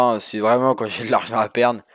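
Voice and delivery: usual speaking voice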